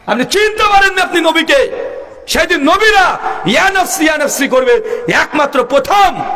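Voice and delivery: high, ringing exhortation; sustained high preacher's reproach; full-force preaching. Real, not acted. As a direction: cry it out high to the congregation. Preacher rebukes listeners at full force.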